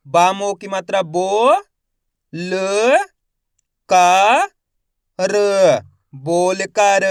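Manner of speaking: slow spelling drill, each syllable held with a rising end; stretched syllables for beginning readers; letter-by-letter sounding out. Shown as a demonstration. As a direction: sound out each letter, then blend the word. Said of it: "एक एक अक्षर का उच्चारण".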